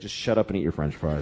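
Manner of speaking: very low, weary dismissal; worn-out mother. Acted. Mother to her questioning kid, worn-out and dismissive. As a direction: brush the kid off in a sunken voice. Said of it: "the parent's like".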